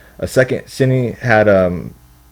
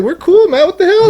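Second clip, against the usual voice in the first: high, giggly mock reply; laughing mock innocence. Retelling the principal scene, high laughing mock reply. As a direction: laugh through it like it's no big deal. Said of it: "They're like".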